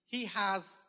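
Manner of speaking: ordinary delivery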